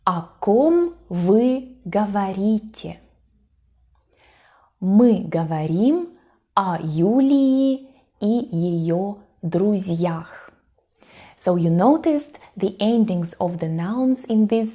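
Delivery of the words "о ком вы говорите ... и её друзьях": slow, careful language-lesson reading; measured, clearly separated example sentences; slow model dialogue. Shown as a demonstration. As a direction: space out each word for learners. Teacher reads model example dialogue.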